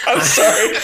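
high choked laughter; laugh, nearly spitting water; laughing while choking. Real, not acted. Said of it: "taking a sip of water".

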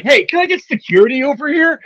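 high-pitched mock call for help; calling for security. Celebrity calling security on a fan, high mock call. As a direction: call across the room for backup.

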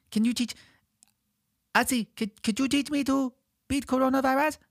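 put-on high little child pleading; toddler voice. Small child asking Ozzy to teach him, high toddler voice. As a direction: ask it like a tiny kid. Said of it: "that's how I talk when I'm two or three".

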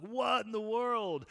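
high, loud, slow amazed cry; astonished exclamation. Retold or imitated. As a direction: marvel out loud in disbelief. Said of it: "I'm like".

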